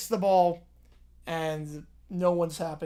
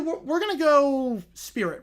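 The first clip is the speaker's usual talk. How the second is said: ordinary delivery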